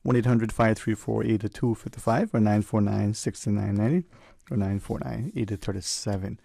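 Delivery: normal talking voice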